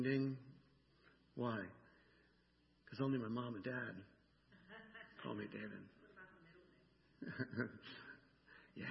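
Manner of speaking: ordinary talk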